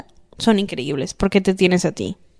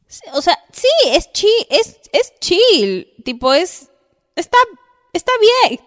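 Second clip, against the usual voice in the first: much higher, halting, unconvincing approval; hedging Bebita; 10 semitones higher, 3 dB louder than usual. Acted. Bebita tells her friend the boy is fine, hedging. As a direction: play a friend squeakily defending a so-so crush. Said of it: "vamos a hacer un escenario ahorita".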